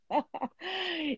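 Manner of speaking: short soft laugh, high; a quick amused chuckle. Real, not acted. Laughs at her joke. (risadinha leve)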